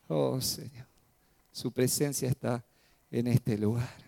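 soft, low, reverent prayer; quiet awe before God; hushed prayer. Real, not acted. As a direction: murmur it in worship.

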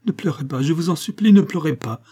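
high, imploring, rising in loudness; urgent pleading. A narrator's reading. Monique on her knees begs madame not to cry, urgent pleading. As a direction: beg with lifted, pressing voice. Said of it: "tomba à genoux et lui tendit les bras".

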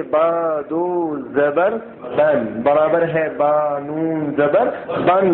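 slow, drawn-out syllable-by-syllable reading; slow spelling out. Shown as a demonstration. Teacher spelling tanween sounds slowly for students. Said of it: "تنوین اور نون ساکن کی آواز یکساں ہوتی ہے".

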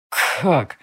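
husky sleepy voice; hoarse. A narrator's reading. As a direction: rasp the word out, just woken. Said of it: "сипло спросила моя прелесть".

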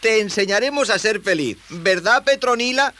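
put-on high, eager young voice; high-pitched, keen youngster; encouraging boy. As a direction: promise it in a high boyish voice. Boy cheers up the creature.

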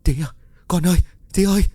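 put-on high frightened calls for her child; panicked crying out. A narrator's reading. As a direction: wake up calling out in terror. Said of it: "cô bật người kêu gào trong hoảng sợ". (kêu gào hoảng sợ)

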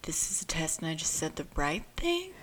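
mock-secretive whisper; whispered aside. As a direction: retell it as a whispered worry to oneself. Same words retold, whispered.

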